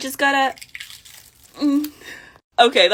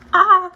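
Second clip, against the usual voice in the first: pain yelp; 8 semitones higher, 4 dB louder than usual. Real, not acted. Hair ripped out.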